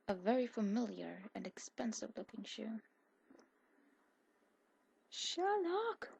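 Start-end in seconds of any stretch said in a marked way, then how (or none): 5.1-6.1 high, rising yell of his name. A narrator's reading. Jane yells for missing Sherlock, high rising yell. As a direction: shout his name across the room. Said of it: "she yells".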